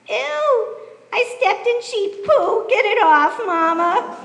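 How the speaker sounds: put-on a small child squealing at the mess; whiny little girl. A narrator's reading. Little Rachel complaining to her mama about sheep poo, whiny squeal. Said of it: "who cried out".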